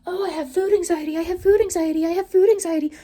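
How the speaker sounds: high, whiny mock complaint. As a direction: parody her whining, high pitched. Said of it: "talks about".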